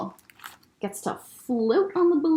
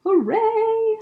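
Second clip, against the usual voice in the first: owl's cheer; 10 semitones higher than usual. Acted. Repeats Owl's shout.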